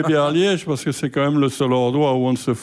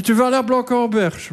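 higher-pitched questioning of the place; incredulous echo; 5 semitones higher than usual. Retold or imitated. Comedian plays partner querying his seaside choice, incredulous echo. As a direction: throw the suggestion back, pitched up.

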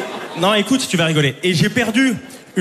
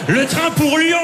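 announcement; 8 semitones higher, slower than usual. Retold or imitated. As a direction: call it out like a station speaker. Station announcement mimic.

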